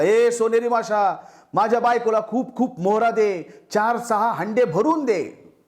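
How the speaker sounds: high, slow call across the water; fisherman calling out. Acted. Fisherman calls to the golden fish at sea, high and slow.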